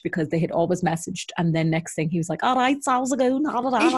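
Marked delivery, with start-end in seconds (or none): 2.4-4.0 imitating lad's chat-up. Retells lad's chatty opening message.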